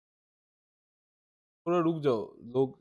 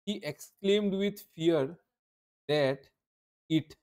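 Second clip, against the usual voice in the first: each word drawn out very slowly; very slow dictation; much slower than usual. Shown as a demonstration. Teacher dictating the answer to students, very slowly. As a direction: spell it out word by word. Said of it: "इसको कैसे change करेंगे?".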